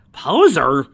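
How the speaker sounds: loud, high, indignant repeat; mock-outraged shout; incredulous outburst.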